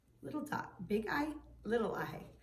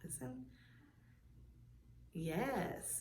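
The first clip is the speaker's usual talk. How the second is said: normal talking voice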